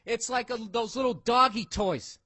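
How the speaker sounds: ordinary talk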